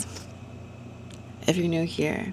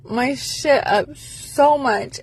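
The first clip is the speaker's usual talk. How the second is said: frustrated near tears; 5 semitones higher than usual. Real, not acted. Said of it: "I wanna cry".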